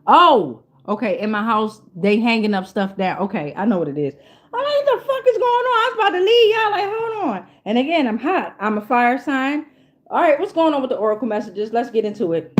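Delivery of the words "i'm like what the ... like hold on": very high squeaky panic, played up; mock alarm. Telling viewers about the knocking, squealing in mock alarm. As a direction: retell your alarm in a squeal.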